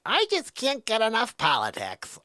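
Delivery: high-pitched eager parody voice; mock enthusiast. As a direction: gush it in a silly high voice. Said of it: "you're like".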